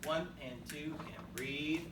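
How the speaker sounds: slow, rhythmic count-in on the beat; steady band-teacher count-off. Shown as a demonstration. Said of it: "Do that one one more time".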